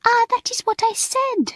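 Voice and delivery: put-on very high, indignant French accent; protesting French mistress. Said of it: "protested Mamselle".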